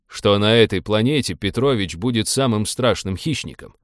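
ordinary delivery, nothing marked

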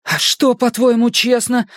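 panting, high retort; out of breath. Acted. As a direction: gasp out the question. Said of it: "тяжело дыша спросил Майк".

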